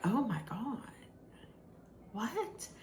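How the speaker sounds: very soft, stunned retold reaction; hushed shock. Everybody reacting to the activist turnaround, hushed shock.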